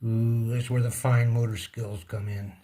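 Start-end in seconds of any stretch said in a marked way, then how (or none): none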